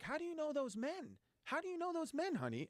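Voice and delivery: very high, suspicious mom voice; a shrill-pitched mother demanding answers; alarmed mom. Retold or imitated. Mother catching her son near the racetrack, very high suspicious voice.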